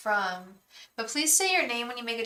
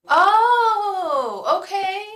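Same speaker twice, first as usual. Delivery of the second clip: surprised oh; 10 semitones higher, 10 dB louder, much slower than usual. Real, not acted. Surprised by the viewer's name.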